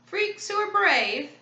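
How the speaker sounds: high, slow mocking disbelief; sneering. A narrator's reading. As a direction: draw it out with a sneer. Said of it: "the hook-handed man sneered".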